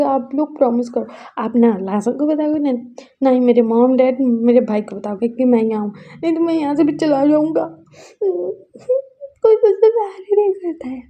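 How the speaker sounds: rising into a high loud tearful cry; crying plea. Acted. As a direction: plead while sobbing. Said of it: "बोल के वो रो रहा था". (रोते हुए गिड़गिड़ाना)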